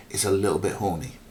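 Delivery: ordinary delivery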